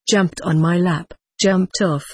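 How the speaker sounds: plain reading voice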